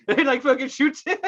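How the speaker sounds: ordinary talk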